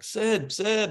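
calling out a name to catch his eye; mock yell. Tipsy fan calling to Sidney Crosby across the room, high mock yell. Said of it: "I'm yelling at him".

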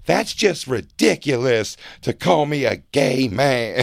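slow, drawn-out effeminate protest; effeminate denial. Acted. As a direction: protest it slowly, with effeminate affectation. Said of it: "it's so effeminate".

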